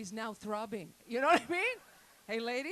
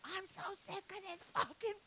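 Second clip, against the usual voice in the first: mock outburst; 9 semitones higher than usual. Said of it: "I lose my shit".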